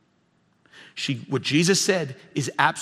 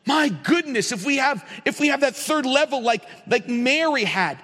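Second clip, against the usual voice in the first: high-pitched, pressing sermon delivery; impassioned preaching; 10 semitones higher than usual. Real, not acted. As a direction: drive the message home with fervor. Preaches at full force.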